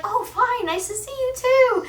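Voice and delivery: very high, mock polite principal; principal's voice. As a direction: squeak out the principal's reply. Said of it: "He'd be like".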